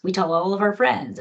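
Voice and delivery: ordinary talk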